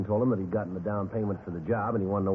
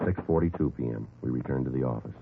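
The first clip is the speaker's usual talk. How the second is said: case narration; 5 semitones lower than usual. Acted.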